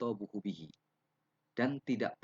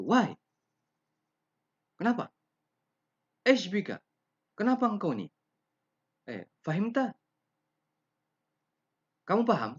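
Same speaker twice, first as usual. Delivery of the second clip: loud, much higher, scornful challenge; rude to teacher; 14 semitones higher, 10 dB louder than usual. Shown as a demonstration. Student rudely questioning teacher in Arabic, loud and high. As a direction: talk down to the teacher. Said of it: "interaksi-interaksi seperti ini tidak pantas".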